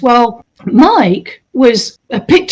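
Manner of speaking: ordinary talk, nothing marked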